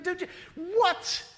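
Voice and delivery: a stunned laughing "what?", high and loud; incredulous angels. Retold or imitated. Angels baffled by humans ignoring God, high and laughing. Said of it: "They're just going".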